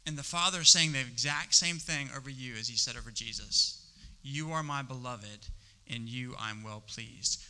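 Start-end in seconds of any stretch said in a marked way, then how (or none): 4.2-7.4 tender voice of God. Acted. Quoting God's tender words.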